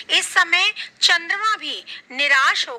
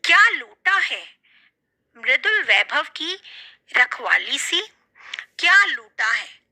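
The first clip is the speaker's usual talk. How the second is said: poem recitation. Acted. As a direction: call the lines out like a poet on stage.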